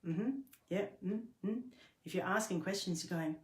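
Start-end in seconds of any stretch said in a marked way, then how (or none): 0.1-1.6 higher, disengaged little listening murmurs; token listening noises. Shown as a demonstration. Listener who isn't asking questions, perfunctory acknowledgement. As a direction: murmur rote acknowledgements without really engaging. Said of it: "you're not going".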